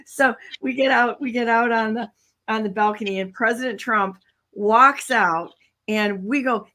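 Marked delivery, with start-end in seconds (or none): none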